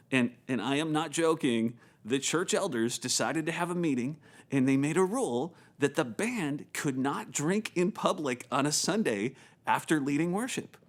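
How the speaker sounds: ordinary talk, nothing marked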